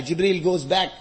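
usual speaking voice

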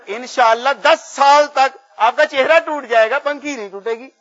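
loud, high, emphatic punchline; retold seller's guarantee. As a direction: promise it like a cocky hawker. (پنکھے والے کی پرزور ضمانت کی نقل)

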